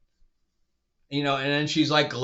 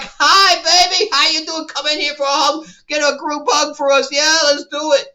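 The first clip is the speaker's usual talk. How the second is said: much higher and louder, sugary hammed-up welcome; mock sweet greeting; 10 semitones higher, 7 dB louder than usual. Reviewer mocking Ahsoka's cheerful welcome, sugary hammed-up cooing. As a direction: coo it out like a doting aunt. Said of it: "It's all like".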